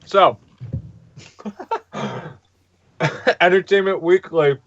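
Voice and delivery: talking around ice cubes; ice in mouth. Real, not acted. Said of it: "me do this with ice in my mouth".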